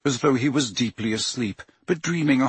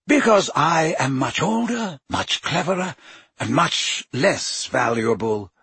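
lightly voiced old wizard, calm; a hint of a wry old headmaster; Dumbledore's voice; 4 semitones higher than usual. A narrator's reading. Headmaster reasoning with Harry, lightly voiced wry.

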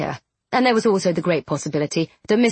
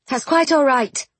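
higher, curt brush-off; snappish; 6 semitones higher than usual. A narrator's reading. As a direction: clip it short, a little annoyed. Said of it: "she said a bit snappishly".

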